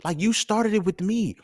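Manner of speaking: put-on high, slow protesting kid; mock student complaint to the teacher; younger self. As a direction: whine it like a wronged twelve-year-old. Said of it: "it's like".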